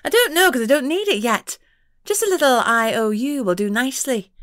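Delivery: high, coy pixie voice; coquettish pixie. A narrator's reading. Pixie to the detective, coquettish and high. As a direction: flirt for the favour.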